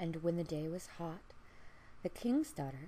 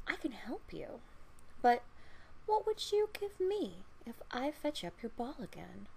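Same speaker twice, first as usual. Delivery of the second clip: lightly voiced frog, higher and sly; 5 semitones higher than usual. Frog bargaining with the princess, higher and coaxing. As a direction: bargain like a crafty little frog.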